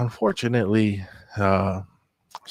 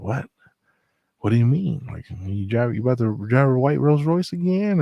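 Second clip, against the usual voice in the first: incredulous question, climbing higher at the end; retold surprise; 4 semitones higher, 3 dB louder than usual. Narrator reacting to his coworker's music comeback, mildly incredulous. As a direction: build to a higher, disbelieving question.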